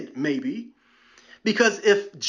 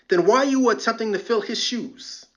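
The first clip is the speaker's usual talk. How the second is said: usual speaking voice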